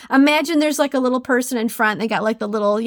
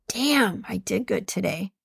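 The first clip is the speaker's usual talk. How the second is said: quiet self-praise; 2 semitones lower, 6 dB quieter than usual. Retold or imitated. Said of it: "I go".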